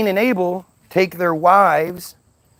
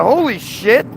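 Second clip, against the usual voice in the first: surprised shout; 7 semitones higher, 3 dB louder than usual. Retold or imitated. Adam voiced in retold Bible story.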